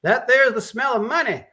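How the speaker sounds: very high, loud whoop. Acted. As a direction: celebrate the stink. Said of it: "Tim Creasy agreed".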